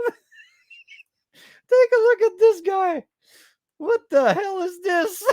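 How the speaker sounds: very high, laughing squeal; high-pitched hilarious outrage; cracking up. Real, not acted. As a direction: squeal in disbelief through laughter. Cracking up at another figure.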